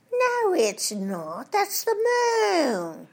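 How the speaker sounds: very slow, rising to a high squeal on moon; dithering English twit, slow and high; Mister Twit. Retold or imitated. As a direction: drag it out, then go shrill on moon. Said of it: "Buffoon says".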